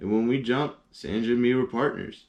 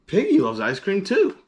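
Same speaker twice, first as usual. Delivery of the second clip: much higher and louder; sudden realization; 10 semitones higher, 5 dB louder than usual. Acted. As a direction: it just dawned on him. Gerald realizes Piggy likes ice cream.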